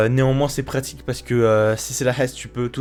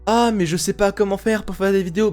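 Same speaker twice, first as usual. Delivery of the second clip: high whiny mock viewer; mock the viewer's whine; 8 semitones higher than usual. Imitating a viewer's complaint.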